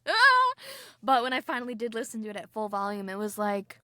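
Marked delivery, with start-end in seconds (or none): 0.1-0.5 short high yelp of fright, much higher and louder; mock scream. Listener scared by a horror episode, mock shriek. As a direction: jolt out a startled shriek. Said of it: "'cause I'm like".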